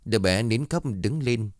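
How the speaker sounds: plain reading voice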